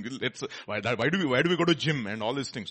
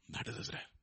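whisper; 14 dB quieter than usual. Real, not acted. Whispered repeat.

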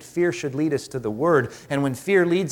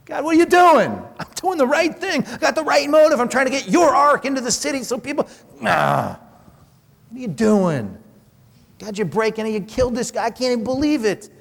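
ticked off; 8 semitones higher than usual. Retold or imitated. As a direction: rail at God like you've been wronged. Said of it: "He's ticked off, man".

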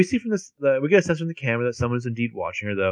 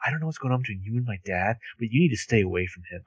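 softer, fast, through gritted teeth; low through gritted teeth; 5 dB quieter, faster than usual. Retold or imitated. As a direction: hiss it as a quiet threat. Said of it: "she says it low through gritted teeth".

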